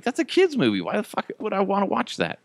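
hammed-up disgust at himself; indignant. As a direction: catch yourself in disbelief. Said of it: "I'm like".

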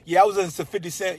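ordinary talk, nothing marked

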